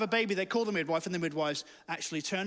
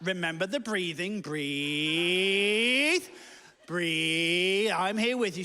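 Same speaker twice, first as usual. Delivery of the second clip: calm wife; much slower than usual. Acted. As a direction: soothe a panicking partner, stretching out each breathe. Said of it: "she stayed so calm".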